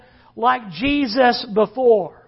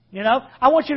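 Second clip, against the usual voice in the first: ordinary talk, nothing marked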